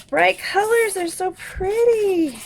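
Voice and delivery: high, loud delight, drawn out at the end; delighted gushing. Real, not acted. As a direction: gush over something pretty, voice soaring. Delighted by the bright colors.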